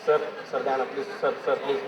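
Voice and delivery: quiet, low begging voice to the teacher; pleading. Retold or imitated. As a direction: beg meekly like a kid in class.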